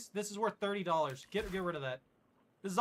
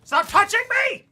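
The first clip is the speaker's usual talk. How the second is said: high, loud hammed-up anguished cries; melodramatic wail; 8 semitones higher, 9 dB louder than usual. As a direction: wail like a tragic hero. Melodramatic plea while dying.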